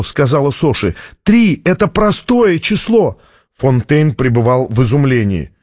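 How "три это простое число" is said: high-pitched urgent exclamation; eager announcement to all; excited realisation. A narrator's reading. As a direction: insist on the discovery, pitch raised. Discovery repeated to the room.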